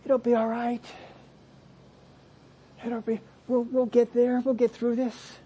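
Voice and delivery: high, hushed cooing reassurance; gently coddle a weeping woman, mocked; soft high pity, the wrong kind of compassion; mock consoling. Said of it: "He's coming over".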